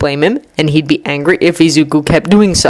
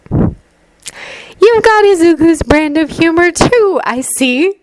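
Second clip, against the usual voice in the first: slow, teasing mom voice; amused mother; 11 semitones higher, slower than usual. A narrator's reading. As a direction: let the amusement linger fondly.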